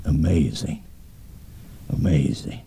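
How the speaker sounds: low, soft, spaced-out wonder; hushed amazement. Retold or imitated. Retells his awe after needle steadied.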